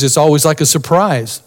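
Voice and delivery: ordinary talk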